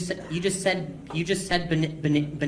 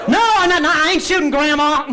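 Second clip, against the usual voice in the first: loud protest; 10 semitones higher, 7 dB louder than usual. Pretend. Comic protest, high and loud.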